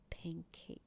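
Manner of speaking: voiceless, soft whisper of pancake; dad whispering pancake to his son, softly; secret whisper. Retold or imitated. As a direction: breathe pancake into a child's ear. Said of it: "偷偷在他耳边耳语".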